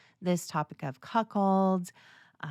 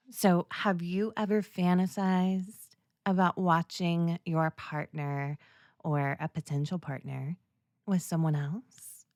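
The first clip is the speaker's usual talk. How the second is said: normal talking voice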